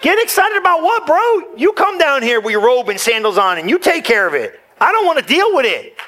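high, laughing mock whine; whiny protest. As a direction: whine it back at him with a laugh. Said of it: "I'm like".

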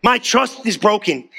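usual speaking voice

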